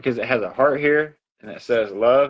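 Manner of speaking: ordinary delivery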